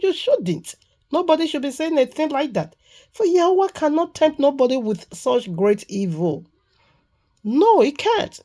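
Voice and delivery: high-pitched scolding of a lying spirit; indignant rebuke. Real, not acted. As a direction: scold them, pitched well up.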